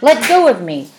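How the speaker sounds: loud, high angry bellow; furious girl yelling. Acted. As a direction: shout to shake off the attacker. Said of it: "Olive bellowed".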